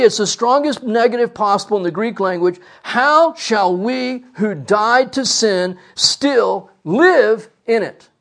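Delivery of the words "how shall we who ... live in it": slow reading with "live" stressed; "live" weighted, the rest of the question slow. Shown as a demonstration. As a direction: let "live" carry the question. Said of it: "I'm putting the emphasis on live".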